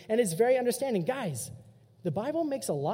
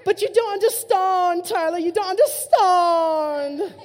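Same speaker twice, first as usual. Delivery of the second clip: high, loud, drawn-out British whine; whiny British accent; 8 semitones higher, 9 dB louder, slower than usual. Retold or imitated. Pastor mocking teens, slow whiny British accent. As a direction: stretch out the last line, whining in British. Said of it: "makes you sound more whiny".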